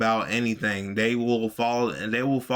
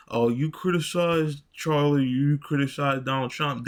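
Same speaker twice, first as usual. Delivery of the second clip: higher, sneering mock accusation; mocking Kari Lake; 5 semitones higher than usual. Vlogger mocking Kari Lake's attack on Tim, higher and sneering. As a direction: parrot her attack back with a sneer. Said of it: "hammering him".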